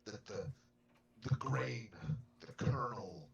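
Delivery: put-on grave, measured old believer; solemn in-character voice. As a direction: speak as a devout elder. In-character speech in the game.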